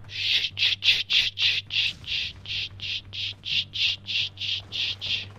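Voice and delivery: rapid string of whispered curses; panicked whispering. Real, not acted. Spotted sneaking player whispering curses in panic. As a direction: hiss the curses under your breath in panic.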